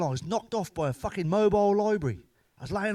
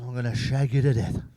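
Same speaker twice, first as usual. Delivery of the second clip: mock menacing voice; 4 semitones lower, 6 dB louder than usual. Threat to wife.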